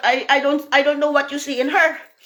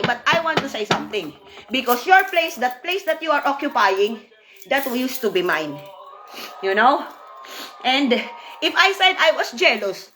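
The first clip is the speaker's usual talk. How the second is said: ordinary talk, nothing marked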